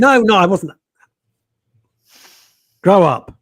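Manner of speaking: loud mock-indignant denial; half-joking outraged no. Real, not acted. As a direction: protest loudly, playfully offended.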